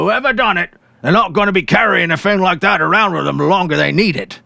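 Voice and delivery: hungry cops gabbing over dinner; gruff policemen chatting. Acted.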